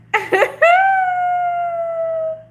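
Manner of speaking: squealing laugh of joy; delighted high laugh. Real, not acted. Overjoyed laugh at a returning viewer. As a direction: shriek of a laugh at seeing him.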